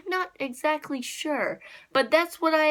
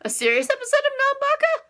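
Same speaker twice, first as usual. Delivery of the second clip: mock surprise; 12 semitones higher than usual. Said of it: "it's like".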